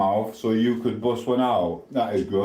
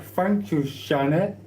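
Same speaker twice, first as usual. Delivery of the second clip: thanks pitched way up, sweet and mocking; mock-sweet high thank you; high sing-song thanks; 7 semitones higher, slower than usual. Shown as a demonstration.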